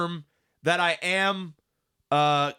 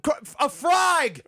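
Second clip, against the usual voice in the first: hammed-up Australian soldier; 9 semitones higher, much slower than usual. Asked by his co-host for a frag-out line, Aussie accent shouted high. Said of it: "use that accent".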